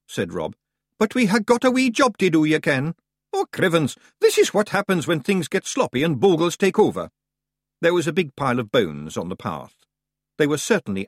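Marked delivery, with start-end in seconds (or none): 1.0-7.1 high, loud broad Scottish pixie voice; a cocky wee Scots fighter; tiny Scots warrior. Acted. Rob Anybody answering the boy, high broad Scots. Rob grumbles about the mess.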